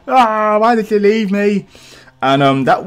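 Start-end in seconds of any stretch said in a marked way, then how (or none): 0.1-1.7 hammed-up wail, pitched high; mock-anguished cry of abandonment. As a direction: imitate an abandoned woman wailing higher. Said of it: "She's like".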